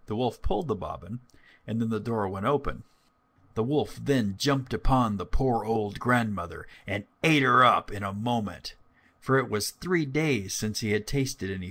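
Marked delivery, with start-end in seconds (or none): none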